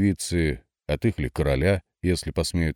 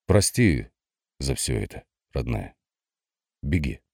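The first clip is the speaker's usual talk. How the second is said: tender farewell; 3 semitones lower than usual. A narrator's reading.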